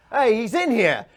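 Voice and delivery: high, loud henchman's alarm; alerting shout. Acted. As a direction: shout to the others that you found him.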